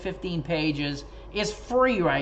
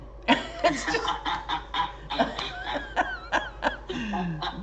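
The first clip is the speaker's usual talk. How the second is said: a long, high, real laugh; real burst of laughter; 4 dB quieter than usual. Real, not acted. Laughing at his mama's verdict on the love story, real high laugh.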